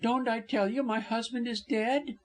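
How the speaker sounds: high, exasperated insistence; distraught wife's demand; vehement. A narrator's reading. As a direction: press the point in a high voice. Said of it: "she demanded vehemently".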